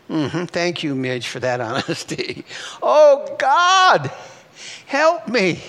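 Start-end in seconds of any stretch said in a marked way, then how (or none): 2.8-5.6 high, loud acted plea to God; crying out in fear. Believer calling to God in fear, crying out. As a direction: cry out for help, voice lifted high. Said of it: "How many have cried out in fear?".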